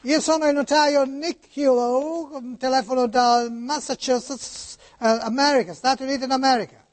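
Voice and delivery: put-on Italian spoken with an American accent. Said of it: "come parla Niculo? ... oggi americani".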